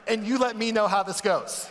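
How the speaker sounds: mock it up with a grin; teasing wife with a boat in the pool; sarcastic offer. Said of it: "So I'm like".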